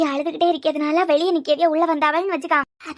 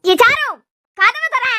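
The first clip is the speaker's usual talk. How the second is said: high, loud call to open up; shouting at the door; 5 semitones higher, 6 dB louder, slower than usual. Acted. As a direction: yell her name, demand the door. Calling through locked door. (உரக்க கத்தி அழைத்தல்)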